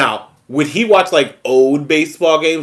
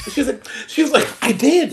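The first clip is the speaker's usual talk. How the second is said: laughing; 13 semitones higher than usual. Retold or imitated. Mother's reply quoted laughing.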